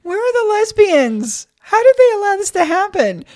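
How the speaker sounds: hammed-up outraged shout; mock outrage. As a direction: play up the shock. Outraged about Super Dick's.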